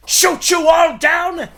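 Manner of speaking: loud, mocking snarl at the captives; gloating villain shout; villain taunt. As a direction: sneer it out, rising to a shout.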